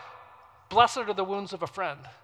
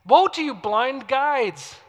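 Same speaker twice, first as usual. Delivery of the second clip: loud, high, slow denunciation; drawn-out rebuke; 6 semitones higher, 9 dB louder, much slower than usual. Acted. Preacher voicing Jesus' woe to blind guides, loud lamenting reproach. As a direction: cry out the woe, stretching each word.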